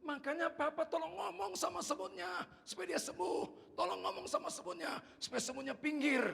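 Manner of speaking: put-on high, insistent child's plea; child pleading. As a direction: plead loudly with papa as a child. Child pleads with papa in story.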